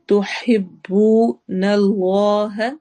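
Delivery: تحبون اللہ with a long stretched بو and a heavy lam; long madd on bu. Shown as a demonstration. Teacher demonstrating madd on تحبون اللہ, slow and stretched. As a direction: stretch the bu vowel in تحبون, very slowly. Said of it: "بو میں واو مدہ ہو رہی ہے".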